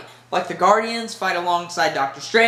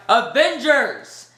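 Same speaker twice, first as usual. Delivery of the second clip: slow, higher rallying call; Captain America; 5 semitones higher, much slower than usual. Retold or imitated. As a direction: draw it out to summon the troops. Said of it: "Captain America steps up and he's like".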